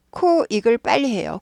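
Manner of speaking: usual speaking voice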